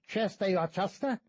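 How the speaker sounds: the guide angrily challenging the priest; angry demand. A narrator's reading. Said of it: "demanded the taller guide angrily".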